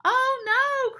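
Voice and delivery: high, loud wail of dismay; dismayed high outcry; alarmed cry. A narrator's reading. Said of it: "cried Penelope".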